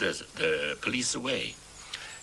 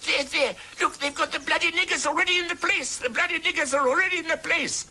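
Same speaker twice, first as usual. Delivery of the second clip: much higher, louder outraged woman's voice; outraged settler woman; 4 dB louder than usual. Retold or imitated. Settler woman in the crowd spotting the Sultana, much higher and louder outraged cry. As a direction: play a woman pointing and crying out in outrage, pitched way up. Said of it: "one South African woman saying".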